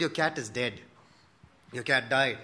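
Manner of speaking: low, flat and blunt; blunt news. Retold or imitated. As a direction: drop the bad news bluntly, no softening. Friend bluntly announces cat's death.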